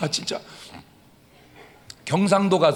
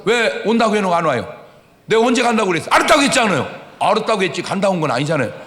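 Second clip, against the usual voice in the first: mock argument; 4 semitones higher, 7 dB louder, faster than usual. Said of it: "내가 가서 따졌어".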